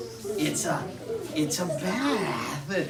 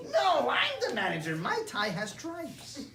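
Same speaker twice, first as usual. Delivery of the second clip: high, soft squabbling mouse; a lightly voiced indignant mouse; second mouse; 4 semitones higher, 5 dB quieter than usual. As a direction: argue like a tiny rival mouse.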